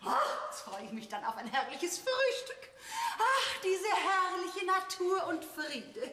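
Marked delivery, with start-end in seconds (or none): 3.2-6.0 slow, lingering praise of nature, higher; slow dreamy rapture. Acted. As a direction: savour each word like fresh air. Städterin schwärmt von Natur. (langsam schwärmend, etwas höher)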